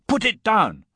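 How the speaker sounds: loud, high-pitched command; alarmed cry. A narrator's reading. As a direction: shout to stop him at once. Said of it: "I cried".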